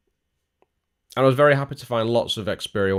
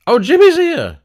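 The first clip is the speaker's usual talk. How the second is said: much higher, loud delighted cry; excited; 14 dB louder than usual. Real, not acted. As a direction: greet a friend with glee. Viewer arrives, delighted.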